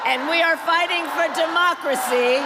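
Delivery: loud, rousing campaign oratory; rally speech. Real, not acted. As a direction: speak out strong over the crowd. Rally speech over hecklers.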